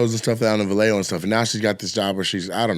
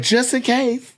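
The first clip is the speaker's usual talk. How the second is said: very high mock-girlish aside; high-pitched, coy imitation; primping girlfriends; 13 semitones higher, slower than usual. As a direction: imitate a girl in a high, put-on voice. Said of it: "so they're like".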